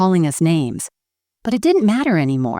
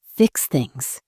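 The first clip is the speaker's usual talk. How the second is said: lower, softer, a hint of a shaking voice; 4 semitones lower, 3 dB quieter than usual. A narrator's reading. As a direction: repeat it quietly, lower, barely holding it together. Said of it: "my voice shaking".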